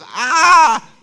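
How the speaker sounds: loud high cartoon wail; mock straining cry, pitched high; strained cry. Pastor mimics his toppling trees, high strained cry.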